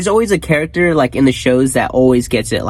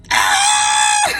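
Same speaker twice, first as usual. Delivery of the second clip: mock cry; 9 semitones lower than usual. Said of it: "I was like".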